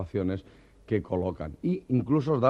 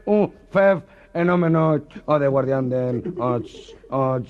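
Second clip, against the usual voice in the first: stutterer; 3 semitones higher, 4 dB louder, slower than usual. Acted. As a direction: choke on each syllable.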